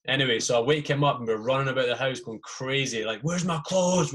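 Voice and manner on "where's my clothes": frantic. Retold or imitated. Running about the house.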